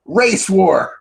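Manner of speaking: high mock-eager exclamation; imitated gleeful announcement. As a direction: announce it like a cheery game. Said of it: "then she goes".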